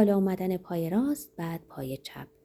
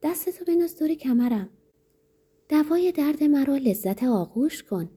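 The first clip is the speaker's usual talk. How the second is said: high-pitched, seductive command to a lover; lightly acted amorous demand; 7 semitones higher than usual. A narrator's reading. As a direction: order an embrace in a raised, coaxing voice. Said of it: "دستور داد".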